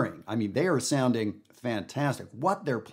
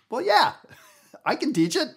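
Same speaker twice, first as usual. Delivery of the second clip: laughing retort; 4 dB louder than usual. Real, not acted. Answering imagined doubt with laugh.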